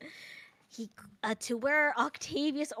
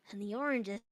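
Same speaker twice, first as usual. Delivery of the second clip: ordinary delivery, nothing marked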